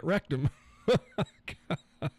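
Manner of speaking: "rectum" said through a laugh. Real, not acted. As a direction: giggle the word "rectum" out. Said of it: "You can say it".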